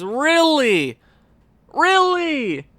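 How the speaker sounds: can't believe it, ask it twice; incredulous. Real, not acted. Disbelief at goalie result.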